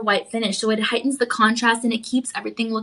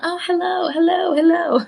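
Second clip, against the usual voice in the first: high, sing-song greeting of an admirer noticing a ring; playful hello; 7 semitones higher than usual. Pretend. Host playing a passerby who notices the ring, high and playful. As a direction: coo hello as if spotting something shiny. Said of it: "You're like".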